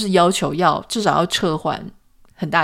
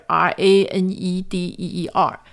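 each letter of R A N E D E E R said separately and evenly; spelling it out. Shown as a demonstration. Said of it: "它Reindeer是怎么拼呢".

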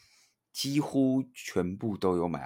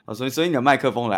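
fast, laughing, higher; 5 semitones higher, 7 dB louder, faster than usual. Real, not acted. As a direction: laugh and rush the words. Laughs then hurries on.

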